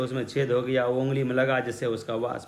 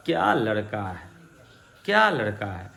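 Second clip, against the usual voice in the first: admiring exclamation; 5 semitones lower than usual. Shown as a demonstration. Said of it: "कहने का तरीका मेरा बदला हुआ है".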